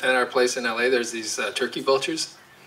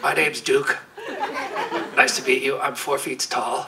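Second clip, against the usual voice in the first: dog voice. Pretend. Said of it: "Duke swears a lot".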